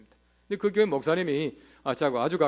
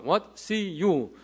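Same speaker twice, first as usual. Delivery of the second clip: Korean-style English; much slower than usual. Retold or imitated. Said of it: "한국식 영어".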